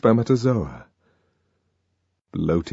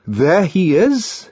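slow, raised accusation; Bernard pointing out; 9 semitones higher, 4 dB louder, slower than usual. A narrator's reading. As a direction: point him out deliberately.

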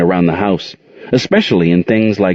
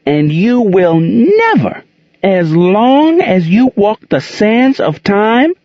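poetic fury; 12 semitones higher, slower than usual. A narrator's reading. Aunt Queen declaiming to Gerald, slow poetic fury. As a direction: declaim the threat like a verse. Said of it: "she got poetic".

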